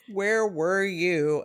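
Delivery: high, loud, drawn-out mock accusation; imitated accusing child. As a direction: act out a kid blaming a parent. Child blaming parent, retold.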